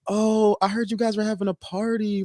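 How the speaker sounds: high-pitched imitation of her angling for an invite; mock sweet-talking party crasher. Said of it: "when Thursday comes around".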